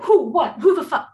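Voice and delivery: sudden high startled yelps; startled alarm. Retold or imitated. Hit from behind in the park, retelling her startled cry. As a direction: jump and shout who's there.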